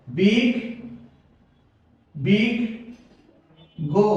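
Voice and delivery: বিগ, বিগ, গো pulled apart with long pauses; syllable by syllable. Shown as a demonstration. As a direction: split the word slowly into বিগ and গো.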